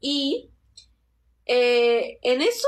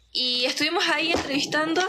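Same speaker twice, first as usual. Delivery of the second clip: ordinary talk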